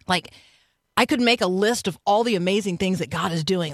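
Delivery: ordinary talk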